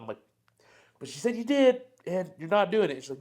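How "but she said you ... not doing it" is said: high-pitched, mildly indignant objection; retold protest. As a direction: push back in disbelief, re-enacted. Retells own protest to the clinician.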